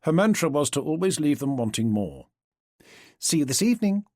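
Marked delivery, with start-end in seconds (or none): 3.2-4.0 put-on English accent. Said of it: "dialed up the English to sound more like May".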